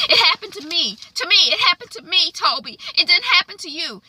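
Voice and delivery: high, pressing hurt protest; a wife voiced up high, hurt and insistent; upset insistence. Wife telling husband the miscarriage happened to her, upset.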